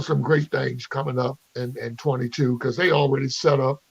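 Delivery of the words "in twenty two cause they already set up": ordinary talk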